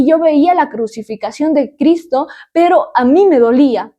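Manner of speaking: normal talking voice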